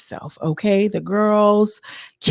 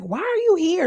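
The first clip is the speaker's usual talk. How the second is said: much higher incredulous mock question; mocking the girls; 12 semitones higher than usual. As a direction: toss it up high in disbelief. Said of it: "they're like".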